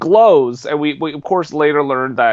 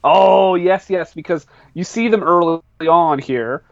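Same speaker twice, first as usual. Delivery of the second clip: ordinary talk, nothing marked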